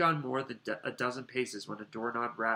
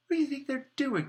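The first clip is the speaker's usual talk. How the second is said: Ron voice; 5 dB louder than usual. Acted. Ron exclaiming, high.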